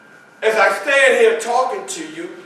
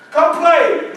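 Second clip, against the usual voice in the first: much higher, louder call; brother calling; 10 semitones higher, 6 dB louder than usual. Retold or imitated. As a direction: coax him to come play.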